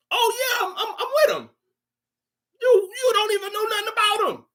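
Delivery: very high-pitched mocking voice; mock bandwagon fan. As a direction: squeal it in falsetto, ridiculing a fake fan.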